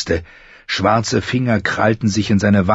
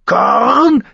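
very high squawked caw; screeching raven; 4 dB louder than usual. A narrator's reading. Raven cries out over the fight, screeching caw. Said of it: "kreischte der Rabe".

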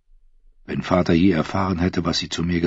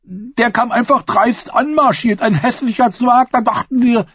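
simple fool voice; 3 dB louder than usual. A narrator's reading.

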